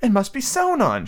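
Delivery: high, a touch condescending; patronizing. Acted. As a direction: talk down to him a little. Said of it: "just a little patronizingly".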